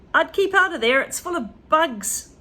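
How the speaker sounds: put-on boy warning Grandma about the attic; George warning. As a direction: warn her off, high and urgent.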